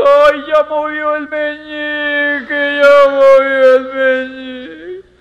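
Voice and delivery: slow, loud, drawn-out cheer; a mentor loudly celebrating the first sign of progress; joyful cheer. Retold or imitated. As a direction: draw it out in triumph.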